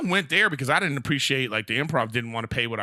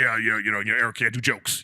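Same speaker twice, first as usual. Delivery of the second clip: lower, put-on voice of a radio wannabe; mocking local celeb; 5 semitones lower than usual. Mocking the radio guy who wants airtime, lower put-on voice. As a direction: mimic a self-important local guy, a touch lower. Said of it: "he wanted to be like".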